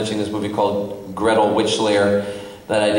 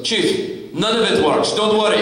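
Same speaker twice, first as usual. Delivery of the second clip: higher, breezy shrug of a reply; Mario reassuring; 8 semitones higher than usual. Retold or imitated. Director brushing off the actor's worry, mock breezy and higher. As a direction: brush it off cheerfully, half-laughing. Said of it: "Mario looks at me and goes".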